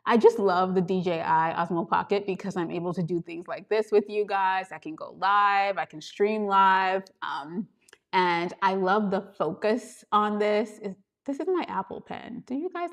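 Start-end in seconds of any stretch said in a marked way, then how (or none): none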